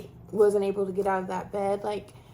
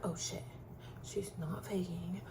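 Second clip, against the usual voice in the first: hushed aside; 3 semitones lower, 13 dB quieter than usual. Retold or imitated. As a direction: breathe it like a secret between staff. Staff realising, said privately.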